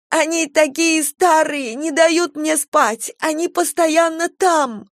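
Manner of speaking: put-on high, slow, haunted girl's voice; wild unhinged ranting. A narrator's reading. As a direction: rave like a terrified girl. Frightened girl's delirious ranting.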